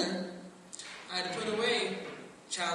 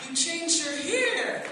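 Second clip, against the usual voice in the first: slow, raised hammed-up husband; mock husband; 9 semitones higher, 4 dB louder, much slower than usual. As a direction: play the husband guessing carefully. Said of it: "If you say".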